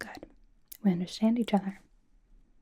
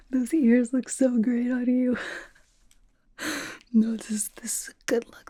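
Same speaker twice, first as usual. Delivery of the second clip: amused, higher-pitched compliment through laughter; laughing; 5 semitones higher, 5 dB louder than usual. Acted. Host admiring the fan's ears on them, laughing. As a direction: gush while trying to contain giggles.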